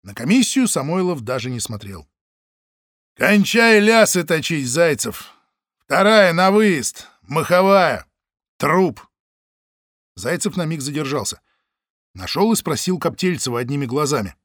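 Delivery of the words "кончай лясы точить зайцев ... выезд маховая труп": slow, loud curt orders; firm command. Acted. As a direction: call the team out. Самойлов командует. (громкий резкий приказ)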